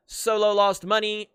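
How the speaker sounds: ordinary delivery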